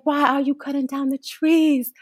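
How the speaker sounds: high-pitched mock yell of a child; retold shout. Six-year-old to a logger over machinery, imitated shouting. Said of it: "shouting over his machinery".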